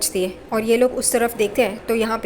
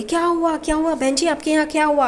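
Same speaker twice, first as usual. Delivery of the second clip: alarmed calling out; 6 semitones higher than usual. Retold or imitated. Neighbour rushing in at noise.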